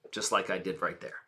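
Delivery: low, very soft aside; subtle emphasis. Shown as a demonstration. Coach shows emphasis need not be screamed, low and subtle. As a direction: drop right down and understate it. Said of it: "emphasis can be subtle".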